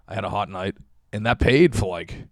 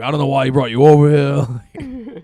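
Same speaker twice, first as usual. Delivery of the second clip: raised, louder imitation of annoyed strangers; mock furious; 5 semitones higher, 7 dB louder, slower than usual. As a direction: act out their indignant grumble, higher-pitched. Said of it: "They were, like, furious at him".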